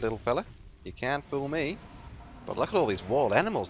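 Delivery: usual speaking voice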